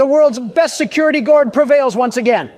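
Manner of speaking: very high, loud, triumphant squeal; gleeful victory cry, way up high; helium voice. Real, not acted. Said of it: "Pardon my helium voice".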